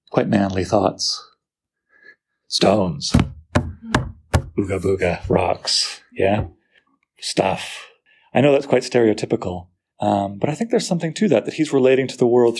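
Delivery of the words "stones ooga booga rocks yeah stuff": low, slow caveman grunt; mock caveman. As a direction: play a dumb caveman naming rocks. Mocking caveman-like manly thoughts.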